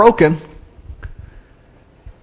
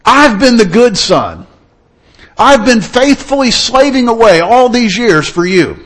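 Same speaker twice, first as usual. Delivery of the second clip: louder, slow, resentful protest; indignant older son; 5 semitones higher, 5 dB louder than usual. Acted. Older son to his father, loud indignant complaint. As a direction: throw your years of loyalty back at him. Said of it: "the older son was indignant".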